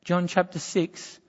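ordinary delivery

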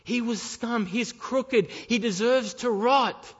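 loud, pleading fire-and-brimstone preaching; full-force preaching. Acted. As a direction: press the congregation hard, loud.

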